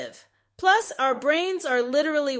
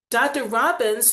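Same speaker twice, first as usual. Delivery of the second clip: ordinary talk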